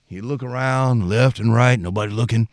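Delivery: usual speaking voice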